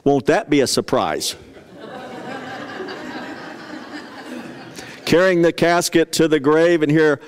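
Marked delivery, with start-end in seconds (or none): 1.9-3.5 a quick amused laugh; short laugh. Real, not acted. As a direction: chuckle at the joke. Laughing at his own joke.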